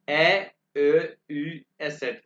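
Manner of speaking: umlaut letters called out again; umlaut repeat. Shown as a demonstration. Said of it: "दोबारा pronounce करूंगा".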